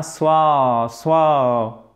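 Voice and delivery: "soit" as a long swaa, the vowel between A and O; demonstrated long vowel. Shown as a demonstration. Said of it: "Donc là, ça nous donnera". (« soit » avec voyelle longue entre A et O)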